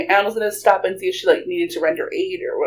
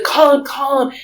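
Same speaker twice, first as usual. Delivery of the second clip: urgent order; 7 semitones higher, 4 dB louder than usual. Retold or imitated. Aunt urgently orders a call.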